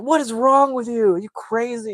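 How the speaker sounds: high, loud mock outrage; outraged yell. James yells at Kevin who threw a dart, outraged. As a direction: imitate an alarmed, indignant yell, pitch shooting up.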